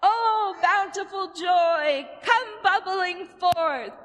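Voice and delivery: high, slow, loud chant of joy; joyful exclamation. Shown as a demonstration. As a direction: call it out big and joyful. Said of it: "One more".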